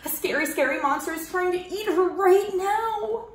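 fast, anxious, rising panic; growing worry. Acted. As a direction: let the fear run away. Gerald imagines dangers.

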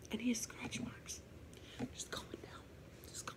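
soft unvoiced spooky whisper. Real, not acted. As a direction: hush it like a ghost story. Revealing scratch marks, spooked.

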